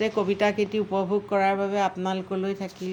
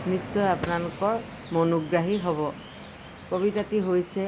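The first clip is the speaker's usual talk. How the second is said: ordinary talk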